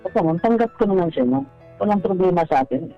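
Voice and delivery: ordinary delivery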